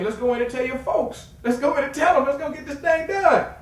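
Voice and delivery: rally him to go tell his folks; fired-up push. Retold or imitated. Urging his player inside, loud fired-up call.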